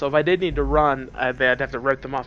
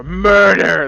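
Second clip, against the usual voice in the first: "Murder!" yelled loud; 5 semitones higher, 12 dB louder than usual. Retold or imitated. Man imagines yelling "Murder!" at kindergarten kids, loud yell. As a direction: belt "Murder!" out. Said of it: "and yell".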